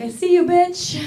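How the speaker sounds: high, loud sassy call-out; playful imitation of a sassy greeting; sassy audience member. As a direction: fire it back with mock attitude. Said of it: "You're like".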